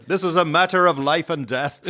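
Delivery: put-on Irish social-justice plea; Irish advocate. Imitating Irish poverty advocates, earnest mock-Irish accent. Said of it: "sound great talking about the poor in their Irish accent".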